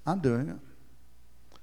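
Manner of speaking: mock self-deceiver assuring himself; quiet smug self-assurance. Preacher mocking a self-deceiving hearer, quietly smug.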